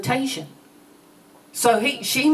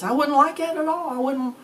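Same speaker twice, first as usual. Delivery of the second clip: put-on high, quick boyish protest; 7 semitones higher, faster than usual. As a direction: play the indignant kid. Preacher voicing boy's reply.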